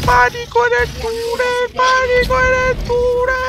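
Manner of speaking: very high, loud screamed panic; panicked shout. Pretend. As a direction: scream it like a disaster movie. Yelling movie title in panic.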